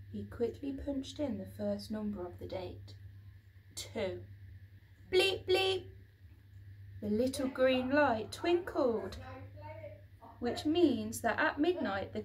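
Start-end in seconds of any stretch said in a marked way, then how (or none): 5.1-5.9 two loud high bleeps; loud high mock keypad beeps; double beep. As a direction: beep twice like a cheerful keypad. Keypad beeps green light.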